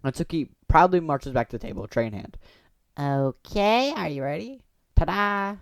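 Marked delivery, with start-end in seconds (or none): 3.0-5.6 bright higher proud reveal; perky girl voice. Acted. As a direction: present it proudly like a show-off girl. Natsuki presents cupcakes.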